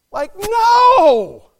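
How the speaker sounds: high, drawn-out shouted protest; loud acted howl of objection; outraged refusal. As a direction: cry out in disbelief, stretching the NO. Acted outrage at the church degrading.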